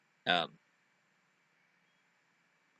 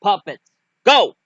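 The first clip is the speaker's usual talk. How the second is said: loud, raised-pitch order; called-out command; 7 semitones higher, 8 dB louder than usual. Acted. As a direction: send the troops in with a shout. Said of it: "Chris was like".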